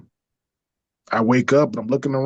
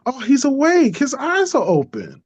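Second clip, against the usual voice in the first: high excited mock yell; imitating a startled nurse calling out; 13 semitones higher than usual. Nurse calling that the patient is awake, high mock yell. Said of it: "she just yells at the top of her lungs".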